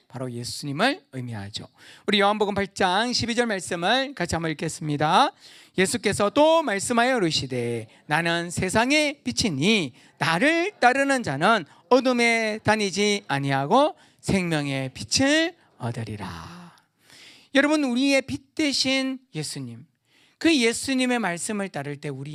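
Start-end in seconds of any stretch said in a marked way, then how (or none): 5.8-16.6 louder, slow solemn reading; formal Bible recitation; scripture reading. Acted. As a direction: proclaim the verse slowly. Reads scripture aloud.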